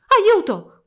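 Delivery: urlo acutissimo e fortissimo; urlo di paura. A narrator's reading. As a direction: grida aiuto terrorizzata. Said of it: "urlò Clara". (Clara vede i topi feroci e urla aiuto, acutissimo)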